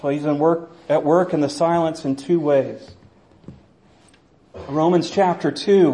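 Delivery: ordinary delivery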